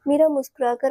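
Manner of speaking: ordinary delivery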